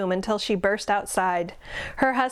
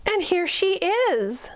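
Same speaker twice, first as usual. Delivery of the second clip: gasp, then much higher cub voice; surprised squeak; 10 semitones higher than usual. A narrator's reading. Baby bear finding the girl in her bed, gasping high squeak. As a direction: squeak out the discovery.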